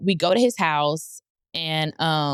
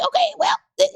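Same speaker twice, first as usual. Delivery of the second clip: much higher, eager "okay"; excited; 4 dB louder than usual. Retold or imitated. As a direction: jump up with excitement. Excited reaction mid-story.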